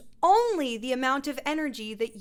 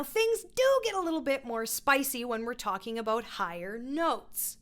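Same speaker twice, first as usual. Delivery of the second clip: ordinary delivery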